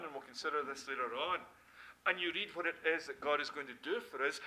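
ordinary talk, nothing marked

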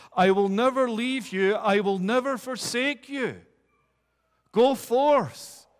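rally the troops with every line; sounding the charge. Acted. Preacher voicing Christ to the church, loud rallying call. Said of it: "sounding forth the charge".

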